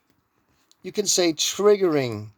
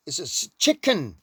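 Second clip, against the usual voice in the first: ordinary talk, nothing marked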